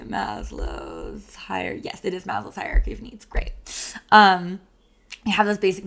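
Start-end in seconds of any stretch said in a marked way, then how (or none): 0.0-1.7 low, slow, soft murmur to oneself; real distracted self-talk, very slow and low; absent-minded lookup. Real, not acted. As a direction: draw it out while looking it up. Let me look that up quickly.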